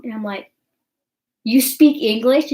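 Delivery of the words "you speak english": louder, rising disbelief; can't believe what you're hearing; shocked question. Retold or imitated. Reacting to boyfriend's mom speaking English, louder astonished question.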